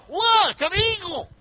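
high, thrilled call to a stranger; high excited cry at an eagle; excited pointing. Pretend. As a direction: grab a stranger and point in awe. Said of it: "you stop strangers".

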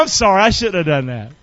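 usual speaking voice

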